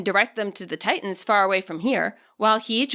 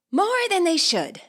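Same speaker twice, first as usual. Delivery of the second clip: slow, higher, sighing reply; sighed reply; 8 semitones higher, slower than usual. A narrator's reading. Juliana answering Nimona about Arvin, a mild sigh. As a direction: let the words out on a weary sigh. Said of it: "Juliana sighs".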